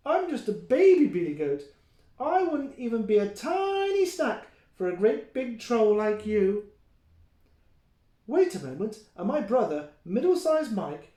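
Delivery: put-on baby goat. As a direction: talk small and cheeky to the troll.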